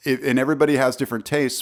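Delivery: usual speaking voice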